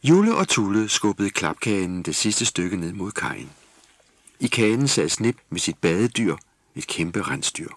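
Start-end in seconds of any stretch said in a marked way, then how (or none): none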